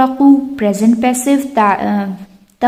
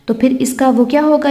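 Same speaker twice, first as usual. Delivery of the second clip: usual speaking voice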